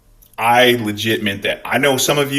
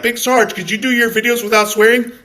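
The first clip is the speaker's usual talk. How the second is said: higher, prissy mock viewer voice; higher mock complainer; 12 semitones higher, 4 dB louder than usual. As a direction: mimic the whiny viewer. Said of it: "all you people that are like".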